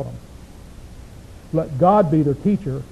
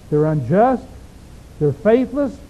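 ordinary talk, nothing marked

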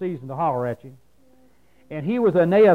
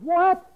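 "What" exclaimed with a big leap up in pitch; exclamation on WHAT; 10 semitones higher than usual. Acted. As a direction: fire out "What" high, as a challenge. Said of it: "Should be an exclamation point".

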